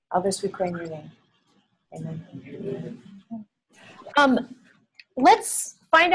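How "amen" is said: normal talking voice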